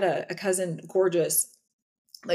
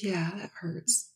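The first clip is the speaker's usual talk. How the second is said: put-on whispered tearful child; 7 dB quieter, slower than usual. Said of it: "And she cried and she goes".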